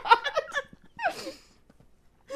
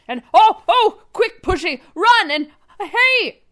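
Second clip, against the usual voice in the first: loud, hammy spy under attack; urgent mock panic; alarmed shouting; 6 dB louder, slower than usual. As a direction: cry out as if suddenly ambushed. Acted struggle while being tied up.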